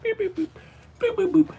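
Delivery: tiny, very high beeps and boops; squeaky spoken boop noises; playful beeping. Real, not acted. Owner playing with the cat, high playful boops.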